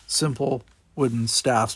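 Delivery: ordinary delivery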